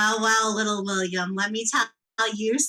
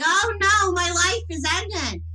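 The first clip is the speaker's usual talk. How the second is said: mock whiny man; 8 semitones higher than usual. Woman mocking men fussing at the doctor, hammed-up whining. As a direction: ham up a big baby's pain. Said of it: "they're like".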